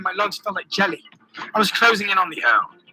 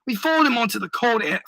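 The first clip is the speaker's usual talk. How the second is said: ordinary delivery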